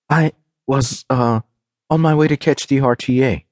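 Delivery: unsure, stop-and-start cover story; hesitant lie. A narrator's reading. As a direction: stall between words while inventing an excuse. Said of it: "she lied".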